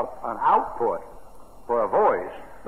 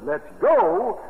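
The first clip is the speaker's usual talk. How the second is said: high, louder call of the Lord; the Lord's call; 8 semitones higher, 4 dB louder than usual. Retold or imitated. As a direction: call it out high like a summons from heaven. The Lord calling the bride up.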